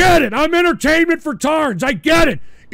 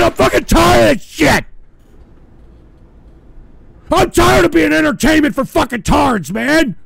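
louder, higher, shouted outburst; furious shout; 4 semitones higher, 5 dB louder than usual. Real, not acted. Streamer hating that he has to do the show, furious shout. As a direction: let the rage boil over for real. Streamer raging, repeated complaint.

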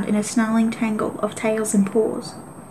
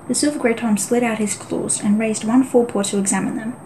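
ordinary delivery

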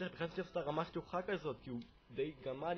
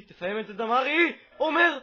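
excited shout; 9 semitones higher, 14 dB louder than usual. Real, not acted. Player urging Damari to finish the chance, shouting excitedly. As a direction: shout climbing much higher and louder. Urging on his striker mid-attack.

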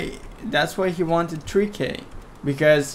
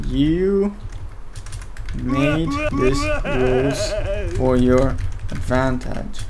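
drawn-out taunt, building louder; mock accusation; 2 semitones higher, 3 dB louder than usual. Caster telling Nightmare off over the rules, mock accusation. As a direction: deliver a hammed-up verdict, word by word.

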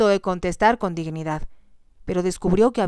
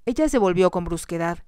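usual speaking voice

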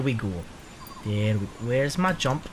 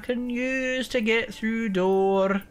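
drawn-out plea; 4 semitones higher, much slower than usual. Acted. Drawn-out plaintive game lines.

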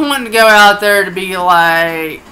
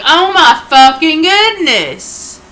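higher, slow, drawn-out exclamation; exasperated, stretched out with a pitch swoop; retold exasperation; 7 semitones higher, 3 dB louder, slower than usual. Player retelling reaction to growling cats, exasperated outcry.

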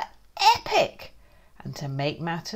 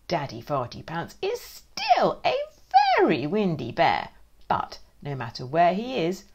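ordinary delivery

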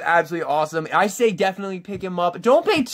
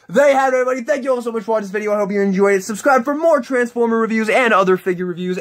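higher, brisk hyped sign-off voice; outro announcer; 6 semitones higher, faster than usual. Acted. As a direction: sell the outro like a host wrapping up. Upbeat channel outro.

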